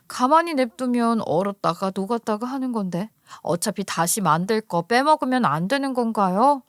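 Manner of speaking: ordinary delivery, nothing marked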